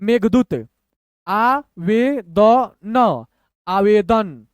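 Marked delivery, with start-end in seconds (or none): 1.3-3.2 very slow, every letter separate; syllable by syllable. Shown as a demonstration. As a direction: spell out the sounds with gaps. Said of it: "तोड़ तोड़ के पढ़िए".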